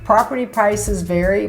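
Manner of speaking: normal talking voice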